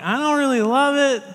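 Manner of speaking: neither love nor hate, half-hearted; lukewarm shrug. Retold or imitated. Churchgoer shrugs at a lunch suggestion, indifferent. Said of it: "And you're like".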